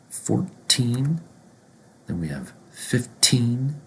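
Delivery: put-on each "teen" number said slowly with a crisp T, rising at the end; clear T in teens. Shown as a demonstration. Teacher modelling the teen numbers for learners, clear T. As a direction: stretch "teen" after a clean T, lifting the pitch. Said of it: "thirteen and thirty, with a T sound".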